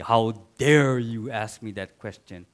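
a mock thundering deity; stern God. Preacher imitating an imagined angry God rebuking. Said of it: "It's like".

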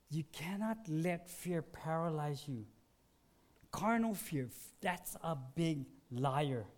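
ordinary talk, nothing marked

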